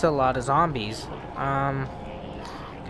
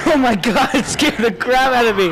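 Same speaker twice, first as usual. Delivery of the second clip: laughing scare; 5 semitones higher, 5 dB louder than usual. Real, not acted.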